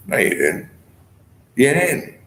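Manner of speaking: sharp, high snippy retort; imitating the woman's huffy tone. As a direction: mimic her dismissive tone. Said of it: "not with that tone of voice".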